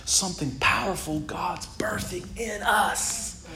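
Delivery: hushed, unvoiced preacher's whisper; soft, breathy reverent whisper; intense whisper. Real, not acted. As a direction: whisper it with awe and weight. Hushed emphasis in the sermon.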